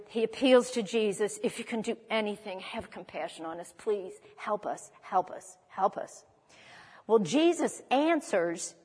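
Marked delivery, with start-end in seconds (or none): 1.4-6.2 hushed, mildly imploring retold plea; desperate appeal. Preacher voicing a desperate father appealing to Jesus, hushed desperate plea. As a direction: plead softly and low, repeating the last words. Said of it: "The guy is desperate".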